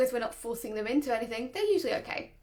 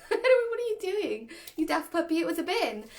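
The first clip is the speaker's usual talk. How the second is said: put-on jolly kids' TV presenter teasing a puppy; upbeat puppy talk; 5 semitones higher than usual. Owner laughing off puppy's bin scare, upbeat. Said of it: "I talk in a very upbeat voice".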